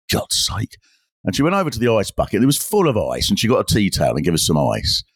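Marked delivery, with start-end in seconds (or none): 0.1-0.8 exasperated mutter. Retold or imitated. Bar woman's grudging mutter.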